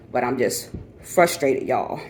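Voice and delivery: normal talking voice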